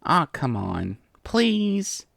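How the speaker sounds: coaxing plea, rising high on "please"; wheedling. A narrator's reading. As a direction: beg with puppy dog eyes. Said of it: "put on her best puppy dog eyes".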